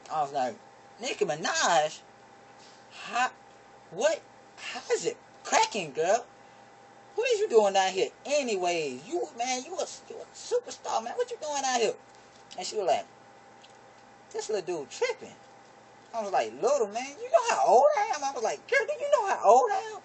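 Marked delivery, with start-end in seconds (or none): 1.0-11.9 high starstruck voice; high and giddy, starstruck. Retold or imitated. Starstruck greeting of girl. 14.3-15.4 put-on girl voice. 16.6-20.0 very high, indignant voice; huffy, much higher pitch; high mock outrage. Indignant age exchange.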